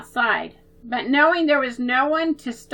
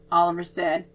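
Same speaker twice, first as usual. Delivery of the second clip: plain reading voice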